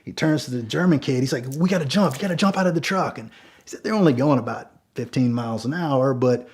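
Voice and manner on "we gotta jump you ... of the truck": urgent plea. Retold or imitated. Urging the kid to jump.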